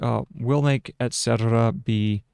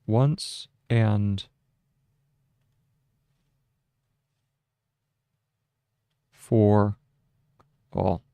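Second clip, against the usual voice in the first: dictating; slower than usual. Shown as a demonstration. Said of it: "so that's period".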